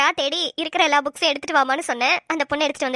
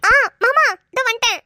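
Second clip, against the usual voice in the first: raised, higher call in answer; answering a shout, louder and higher; calling back; 5 semitones higher, 5 dB louder than usual. Acted. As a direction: call back brightly to someone across the way. Answering husband's call from a distance.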